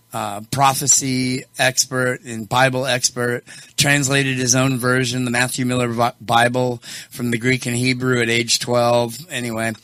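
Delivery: normal talking voice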